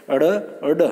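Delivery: अड़ and अड with the tongue flicked down; retroflex flap demo. Shown as a demonstration. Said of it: "दो extra बताए थे". (अड़ और अड का उच्चारण)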